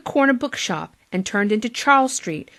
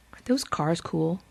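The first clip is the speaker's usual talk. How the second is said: plain reading voice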